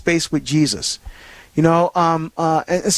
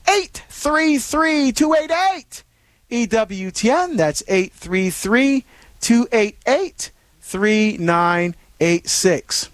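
slow number read; 3 semitones higher, much slower than usual. Real, not acted. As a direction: spell out the number slowly. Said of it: "reading the call-in number".

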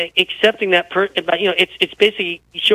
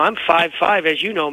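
ordinary talk, nothing marked

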